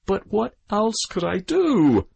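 high, slow plea to the crowd; appealing. A narrator's reading. The boy appeals to the crowd and his mother. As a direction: spread your hands and plead. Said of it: "appealing to the crowd now".

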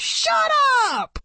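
sudden high scream; a boy losing it, high screaming. Acted. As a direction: snap and scream it at the boys. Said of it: "He turned and screamed".

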